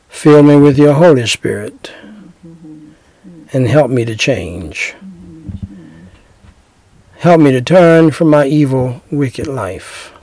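earnest, measured prayer voice. Acted. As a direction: pray it aloud for the listener to repeat. Leads a sinner's prayer.

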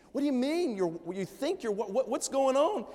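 high, flustered husband in a panic; retold panic. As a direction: act out the panicked husband's rush of questions. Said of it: "So I start panicking".

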